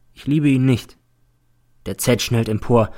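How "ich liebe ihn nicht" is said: ordinary delivery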